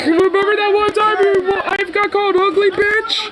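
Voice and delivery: sneering heckler jab about his wife; mock heckler. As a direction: taunt him like a heckler in the crowd. Mocking Cruz like the hecklers.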